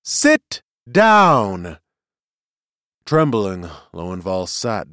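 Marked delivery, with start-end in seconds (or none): 0.0-1.8 loud, high order; ringing command. Acted. Seer orders the heckler down, ringing voice. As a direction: command that fills the hall. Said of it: "in a voice ringing throughout the hall".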